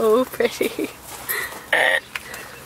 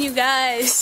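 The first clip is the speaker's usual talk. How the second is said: ordinary talk, nothing marked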